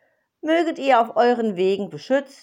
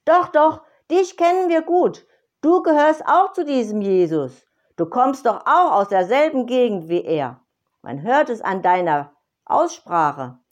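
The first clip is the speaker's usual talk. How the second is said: higher, louder mocking insistence; crowd insisting; 5 semitones higher, 7 dB louder than usual. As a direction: press him hard, sure of it. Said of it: "umringten Petrus und riefen".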